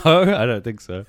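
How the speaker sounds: loud, amused, laughter breaking in; laughing denial. Real, not acted. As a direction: deny it through a real laugh. Laughs at being asked if imitating her.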